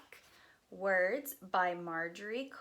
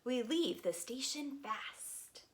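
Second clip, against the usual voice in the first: lively, sing-song picture book reading; reading to children; 6 semitones higher, 4 dB louder than usual. Acted. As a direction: read it aloud to a group of little kids.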